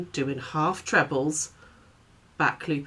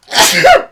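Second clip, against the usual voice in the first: sudden loud sneeze; a real sneeze bursting out; sneezing; 15 dB louder than usual. Real, not acted. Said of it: "I'm gonna sneeze".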